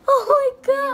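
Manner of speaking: loud cry of pain. Real, not acted. Sudden pain, crying out.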